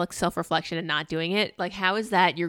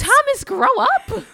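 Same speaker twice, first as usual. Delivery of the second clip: loud, high, drawn-out scolding; exasperated outburst; 10 dB louder, slower than usual. Real, not acted. Exasperated scolding of film character.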